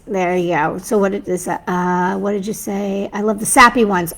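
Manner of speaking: ordinary talk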